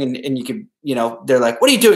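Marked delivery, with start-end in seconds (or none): 1.6-2.0 high, loud baffled outburst; mock bewildered yelp; incredulous boss. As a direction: react like you can't believe it. Confused boss reacting to weirdness.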